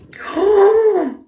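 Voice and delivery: high, sudden gasp of surprise; a real startled intake of breath; sharp gasp. Real, not acted. Reacting to a surprise graphic.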